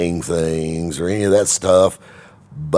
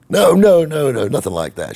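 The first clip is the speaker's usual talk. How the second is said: eager denial; 11 semitones higher, 8 dB louder than usual. Retold or imitated. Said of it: "I'm like".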